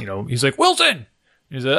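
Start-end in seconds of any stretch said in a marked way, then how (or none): 0.6-1.1 sharp high call; calling out a name. Retold or imitated. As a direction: snap the kid's name to get attention. Coach calling out a talking kid.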